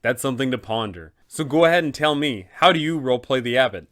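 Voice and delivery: usual speaking voice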